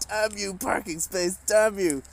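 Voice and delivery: hammed-up fury at a parking space; angry curse. Cursing the parking space in mock rage. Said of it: "looking at it in an angry".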